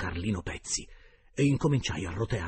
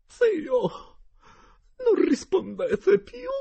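much higher, loud coaxing whine; insinuating voice; 11 dB louder, much slower than usual. A narrator's reading. Geltrude coaxing the silent uncle, high insinuating whine. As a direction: coax the silent spirit. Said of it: "con voce insinuante".

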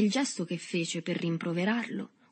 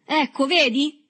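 high, loud gotcha; triumphant shout; 8 semitones higher, 8 dB louder, slower than usual. A narrator's reading. Boy shakes a fist at Berto, shouting high. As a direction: crow it loudly, fist shaking. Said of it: "gridò l'altro scuotendo il pugno".

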